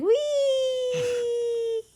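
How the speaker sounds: put-on long high baby squeal; gleeful baby. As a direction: squeal wee as if swung upside down. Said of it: "And the baby's like".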